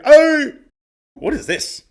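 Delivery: sudden loud high yelp; surprised hey. Real, not acted. Vlogger startled by a weird card shape, yelps out loud. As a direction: jolt at something strange.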